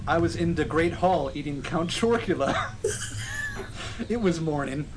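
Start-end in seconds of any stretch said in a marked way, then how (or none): 2.8-4.0 high breathless giggle; a helpless wheezing laugh. Real, not acted. Reader cracking up at fanfic.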